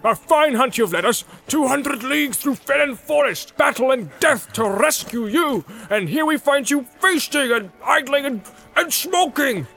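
high, blustering dwarf tirade; Gimli, joyful rage. Acted. Dwarf scolds the feasting hobbits he hunted, high and outraged. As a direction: scold them, bursting with outrage and joy. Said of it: "so torn between rage and joy".

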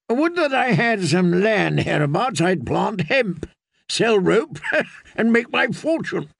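high, wry character voice with a laugh; jesting knight. Acted. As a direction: joke darkly, chuckling toward the end. Sir Hyle jests about hanging.

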